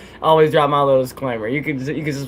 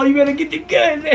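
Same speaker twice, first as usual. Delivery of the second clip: playful shout; 9 semitones higher than usual. Real, not acted. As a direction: shout a playful warning to a viewer. Shouting at Solo Dolo.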